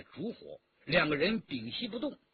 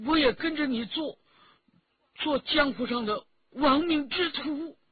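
shy, desperate, near tears; 6 semitones higher than usual. A narrator's reading. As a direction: force it out with great effort until tears break through. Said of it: "说到最后又羞又急， 竟哭了出来". (又羞又急，越说越高，最后哭出来)

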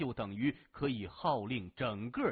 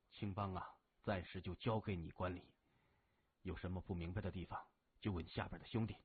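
lower, softer voice of a hospitalized leader entrusting his gang; earnest request from a sickbed, low and soft; a grave older brother handing over duty; Gao Zhen, serious and earnest; 6 semitones lower, 7 dB quieter than usual. Acted.

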